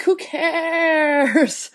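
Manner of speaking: creaky, drawn-out fry voice; vocal fry. Pretend. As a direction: drag it out in a deliberate vocal fry. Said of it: "I love that you just did intentional vocal fry".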